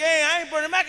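ordinary talk

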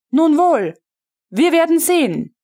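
a threat under polite words; ominous resolve. A narrator's reading.

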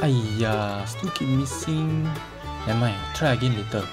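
usual speaking voice

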